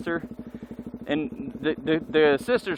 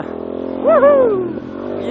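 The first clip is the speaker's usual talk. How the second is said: high, loud whoop; excited cheer at a stunt; 6 dB louder than usual. Real, not acted. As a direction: whoop for the jump. Cheering a bike jump.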